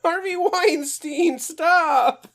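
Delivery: high, loud, slow scolding yell; scolding the dog. Real, not acted. Yelling at his dog Harvey to stop biting, high and loud. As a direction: shout the dog's full name to stop it.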